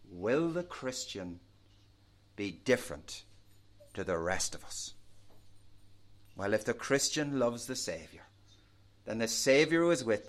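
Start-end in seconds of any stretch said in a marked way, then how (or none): 0.1-4.9 quiet wondering. Acted. Preacher voicing watching patients' question, quiet wondering. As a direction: voice their unspoken question, hushed and low.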